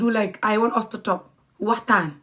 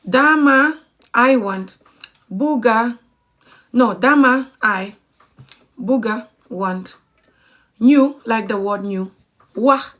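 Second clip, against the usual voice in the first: word by word, with pauses between; slow teaching demonstration. Shown as a demonstration. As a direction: spell it out slowly for learners. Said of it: "I'll try and say it slowly".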